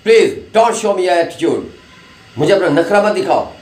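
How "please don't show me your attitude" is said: each word spelled out slowly; a teacher demonstrating careful English, slowly. Shown as a demonstration. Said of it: "अब देखो ना, मैं बोल रहा हूँ".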